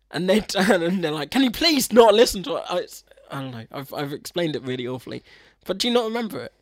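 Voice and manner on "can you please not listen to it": loud, higher imitated protest; mock-indignant outburst; retold exasperated plea. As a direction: act out a pointed request, raised voice. Retold exasperated request from the scene.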